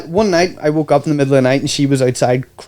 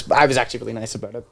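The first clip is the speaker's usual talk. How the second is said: ordinary delivery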